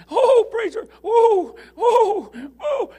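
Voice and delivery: put-on high, loud, laughing old lady on the phone; excited old lady. Preacher imitating Martha on the phone, high and laughing. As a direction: squeal it out like an overjoyed old woman.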